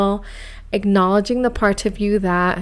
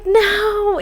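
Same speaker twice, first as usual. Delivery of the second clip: high squeaky no; 11 semitones higher than usual. Retold or imitated. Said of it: "I'm like".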